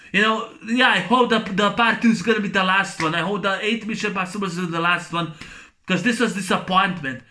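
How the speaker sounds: ordinary delivery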